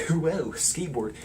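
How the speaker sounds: a hint of a slacker drawling; mock skater cool; too cool for school. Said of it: "some too cool for school guy".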